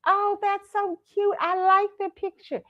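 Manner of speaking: put-on high, slow cooing old lady; grandma voice. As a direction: coo it slowly like grandma. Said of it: "She's like".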